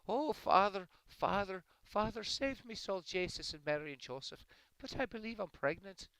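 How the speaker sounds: high, loud Irish woman wailing to her priest; hammed-up panicked confession in a brogue; distraught Irish woman. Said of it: "this Irish woman goes to confession".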